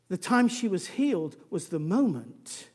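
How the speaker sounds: ordinary talk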